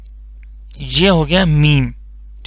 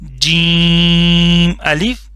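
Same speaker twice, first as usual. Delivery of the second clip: drawn-out syllable reading, slow and clear; slow teacher demo; much slower than usual. Shown as a demonstration. Teacher reciting the letter for students, slow and drawn out. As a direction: model the letter name slowly for the class. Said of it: "ٹھیک ہے".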